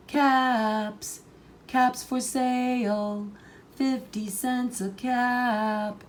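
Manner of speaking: high, slow sing-song street call; peddler calling out. A narrator's reading. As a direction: call out your wares to the whole street. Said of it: "walked down the street calling".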